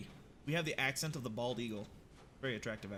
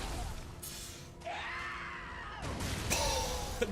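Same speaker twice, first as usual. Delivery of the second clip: long high wheezing laugh; helpless laughter. Real, not acted. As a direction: break up laughing mid-sentence. Laughs mid-sentence.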